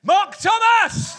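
slow, high drawn-out announcement; calling the headliner onstage; announcer shout. Real, not acted. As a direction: boom the name like a ring announcer.

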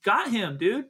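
high, emphatic correction; indignant. Real, not acted. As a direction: drive each phrase home, exasperated. Indignantly correcting his co-host.

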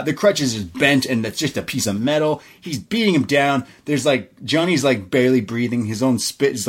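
ordinary delivery

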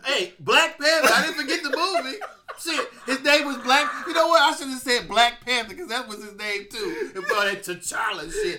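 loud laughing protest; cracking up while talking; laughing loudly. Real, not acted. Caught forgetting name, laughing.

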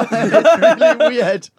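laughing, slow and high; giggling it out slowly. Real, not acted. Cracking up mid-story.

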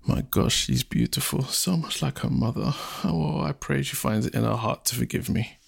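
soft, hushed musing; inner thought. Acted. Chief silently praying his daughter forgives him, hushed. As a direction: murmur it privately to yourself. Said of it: "and thought".

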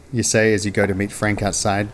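plain reading voice

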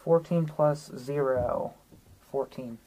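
usual speaking voice

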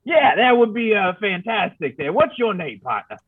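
a grizzled frontiersman rambling; old prospector voice. Acted. Player voices his character.

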